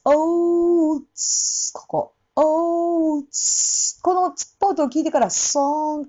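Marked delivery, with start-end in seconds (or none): 0.0-4.0 オーツ held long with a popping ts; オーツ slow, tongue releasing into ts; old into s. Shown as a demonstration. Teacher showing how old links to the next word. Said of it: "ツッポートがします".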